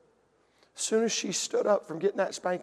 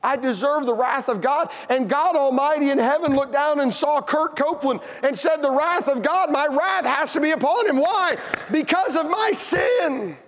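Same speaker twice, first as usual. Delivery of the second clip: climbing, impassioned proclamation; full-force preaching; 7 semitones higher than usual. Real, not acted. Preacher declaring God's wrath upon himself, impassioned preaching.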